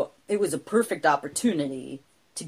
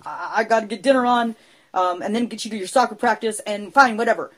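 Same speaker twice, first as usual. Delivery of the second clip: put-on higher, louder, exasperated mom brushing the kid off; busy mom giving in; 5 semitones higher, 6 dB louder, faster than usual. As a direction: wave the kid away while busy cooking. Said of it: "she'd usually just say".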